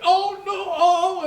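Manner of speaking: put-on high, whimpering baby-like whine; whiny man child. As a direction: whine like a scared big baby. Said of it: "he's whining like a baby".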